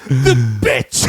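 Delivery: low, forceful imitated outburst; mock pissed-off panic. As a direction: act out his furious panic. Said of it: "when he has pure panic".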